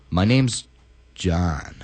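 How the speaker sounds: low, slow, drawn-out name; deep John voice. Retold or imitated. Retelling the plumber, deep and slow. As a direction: drop way down for John. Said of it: "He said it like this".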